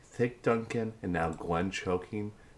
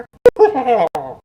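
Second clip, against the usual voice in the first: loud laugh; 17 dB louder than usual. Real, not acted. Laughing after Stitch impression.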